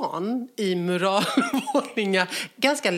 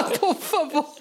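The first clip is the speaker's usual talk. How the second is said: laughing mock Spanish; 6 semitones higher than usual. Quotes Mia in Spanish, laughing.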